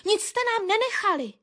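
high, indignant bird voice; angry accusation. A narrator's reading. Nuthatch blames the tits for eaten fat, angry high voice. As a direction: accuse them, voice pitched right up. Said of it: "zlobil se Brhlík".

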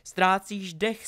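high, slow, loud jeer; loud taunt. Acted. Draco teases Harry mid-duel, loud taunt. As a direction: call the taunt out across the fight.

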